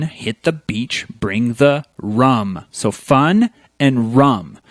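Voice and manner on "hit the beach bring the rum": slow recited. Shown as a demonstration. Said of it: "a family rhyme".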